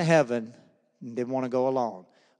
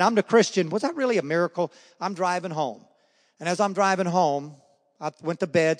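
ordinary delivery

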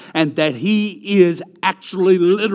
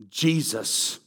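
ordinary delivery

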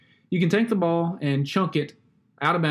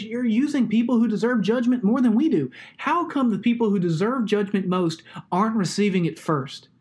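higher, pressing questions to God; pleading complaint; 5 semitones higher than usual. Retold or imitated. Prophet Habakkuk complaining to God, higher and pleading. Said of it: "He, he says".

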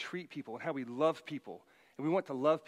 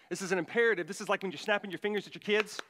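ordinary talk, nothing marked

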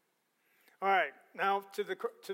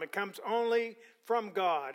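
ordinary talk

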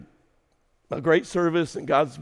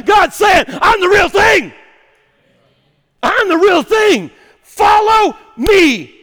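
high, loud, shouted sermon climax; thundering pulpit call; full-force preaching; 13 semitones higher, 10 dB louder, slower than usual. Acted. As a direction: preach it out with everything.

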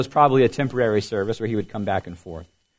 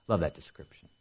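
whispered aside; 8 semitones lower, 12 dB quieter than usual. Real, not acted. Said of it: "aside after the reading".

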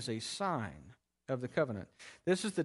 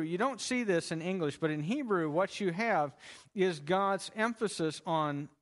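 usual speaking voice

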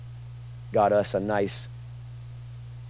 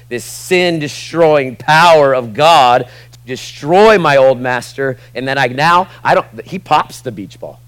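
louder, slower, each word leaned on; slow emphatic preaching; 7 dB louder, slower than usual. Acted. Preacher stressing God's power to the congregation, slow and loud. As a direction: drive the truth home slowly.

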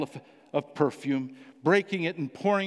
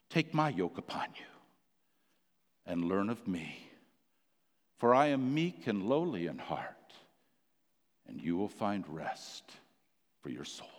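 whispered, then fading low and soft; from whisper down to a low, soft hush; hushed tender invitation; 7 semitones lower, 5 dB quieter than usual. Acted. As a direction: draw them in with a whisper that dies away. Tender quoted invitation of Jesus.

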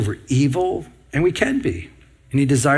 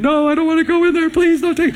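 high, fast pleading cry of a resident; mock panicked wail, pitched way up; imitating screaming patients; 3 dB louder than usual. Nursing home resident refusing the activity room, panicked high wail. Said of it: "I'd hear people screaming".

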